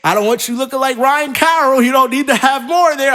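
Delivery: high, loud scolding shout; mock scolding. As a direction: yell at them to stop eating. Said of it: "And then I gotta say".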